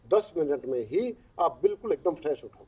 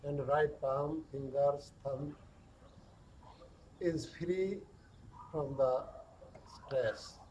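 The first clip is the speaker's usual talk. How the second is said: very slow, hushed body-scan voice with long pauses; guided meditation; 2 semitones lower, 8 dB quieter, much slower than usual. Acted. Yoga teacher guiding stress down the arms, slow guided meditation.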